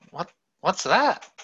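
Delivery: halting, high, slow question with rising end; bewildered questioning. Retold or imitated. Buddha asks his guard about the procession, bewildered. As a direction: imitate someone stunned and needing an answer.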